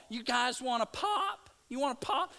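loud, high, hammed-up Michigan accent; mock Yankee accent. Preacher mimicking Yankee relatives at a reunion, mock Northern accent. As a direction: imitate a Northerner pushing a "pop". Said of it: "and that's how they talk".

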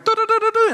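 high beeping radar noise; radar beep imitation. Preacher telling the church how the radar went off.

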